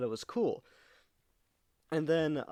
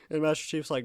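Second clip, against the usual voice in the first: ordinary delivery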